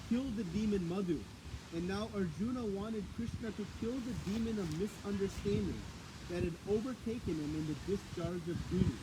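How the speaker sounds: normal talking voice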